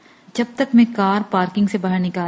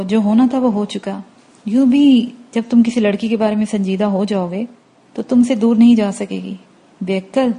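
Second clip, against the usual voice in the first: ordinary delivery, nothing marked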